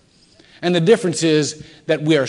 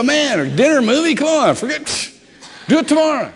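high, loud mock exasperation; exasperated outburst; 12 semitones higher, 5 dB louder than usual. Husband whose date plans are dashed, exasperated. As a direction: blurt it out like a fed-up husband. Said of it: "So I'm starting to build up".

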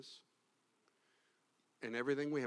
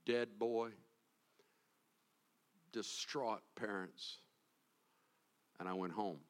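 ordinary talk, nothing marked

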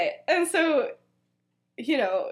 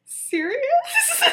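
laughing question; 4 dB louder than usual. Retold or imitated. Confused call in retold story.

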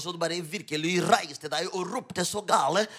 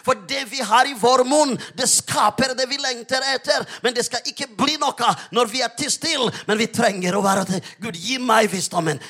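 urgent sermon swelling to loud, higher declarations; fervent preaching, building; 7 semitones higher, 9 dB louder than usual. Real, not acted. Preacher urging believers to speak out, fervent preaching building in force. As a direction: build relentlessly into bold proclamation.

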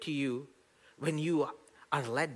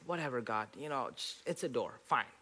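grudging mutter; 2 semitones lower, 7 dB quieter than usual. Retold or imitated. Grudging reply to God retold.

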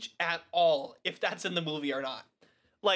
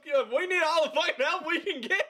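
high-pitched mock desperation; Nick Fury; 10 semitones higher, 3 dB louder than usual. Commander on a sinking ship wants prayers, mock desperate. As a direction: plead urgently in a hammed-up high voice. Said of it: "He goes".

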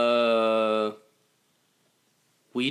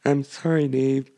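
evenly measured computer voice; Hal. Acted. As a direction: impassive machine refusal. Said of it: "it sounds like Hal".

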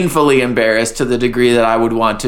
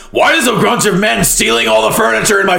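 angry shout; 6 semitones higher than usual. Retold or imitated. Angry quote of character.

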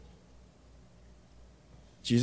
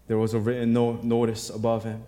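ordinary delivery

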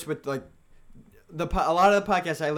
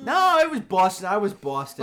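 put-on louder, higher Boston accent; 4 semitones higher, 6 dB louder than usual. Arguing with friends about the car, in a Boston accent. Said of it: "talk with a Boston accent".

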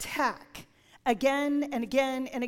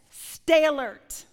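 loud, slow warning; emphatic command; 3 semitones higher, 9 dB louder, slower than usual. Acted. Preacher warning the church to stay alert, loud. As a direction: call the congregation to attention. Preacher reads scripture forcefully.

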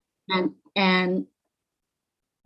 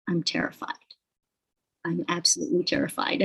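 much softer, a small scared confession; quietly terrified; 2 semitones lower, 5 dB quieter, faster than usual. Retold or imitated. To the start guy who calls her a star, a soft frightened admission. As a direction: let the fear slip out quietly.

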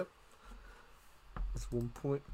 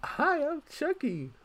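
high, slow creepy doll voice; squeaky talking doll, drawn out; Chucky doll voice; 14 semitones higher, 5 dB quieter, much slower than usual. Retold or imitated. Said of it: "the stupid doll voice".

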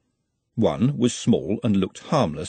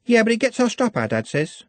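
put-on higher, eager girl's voice; young girl Juliet; 12 semitones higher than usual. Juliet insists to Glenda, high and eager. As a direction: voice her as a chatty young girl.

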